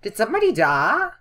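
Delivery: high, loud, alarmed question; startled question. Real, not acted. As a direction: blurt it out in sudden disbelief. Startled by a game sound.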